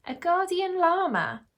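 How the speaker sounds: high, disbelieving echo; incredulous. A narrator's reading. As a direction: question it high, finding it absurd. Yasmin cannot believe it.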